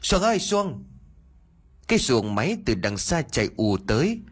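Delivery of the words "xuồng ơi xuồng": much higher yell to a distant boat; shouting. Acted. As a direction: call out across the river. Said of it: "rồi hét lớn".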